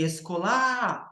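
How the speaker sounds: "escolar" stressed at the end as esco-LÁ; wrong stress on LA. Shown as a demonstration. Teacher rejecting a second wrong version, "escolar" stressed on final LÁ. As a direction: land hard on the final LÁ of "escolar". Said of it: "e nem escolar".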